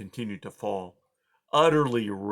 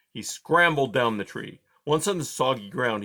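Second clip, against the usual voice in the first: ordinary delivery, nothing marked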